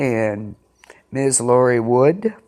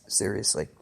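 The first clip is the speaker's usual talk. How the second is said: normal talking voice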